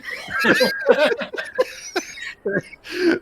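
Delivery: loud, high burst of laughter. Real, not acted. Laughs after joke.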